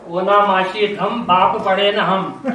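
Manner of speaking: ओनाम आशीधम drawn out slowly, rising, then बाप पढ़े न हम slow; slow rhyming retort. Retold or imitated. The pandit answering the father's mangled chant, slow sing-song rhyme. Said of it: "तो पंडित जी ने कहा".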